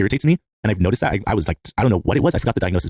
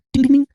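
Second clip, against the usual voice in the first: mock glass tapping; 5 dB louder than usual.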